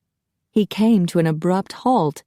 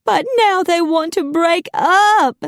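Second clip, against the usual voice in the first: high melodramatic wail; lip-trembling upset; 13 semitones higher, 5 dB louder than usual. A narrator's reading. Macy tells Apollo of the breakup, high tearful wail. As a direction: wail it like a heartbroken matchmaker. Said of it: "his bottom lip trembled".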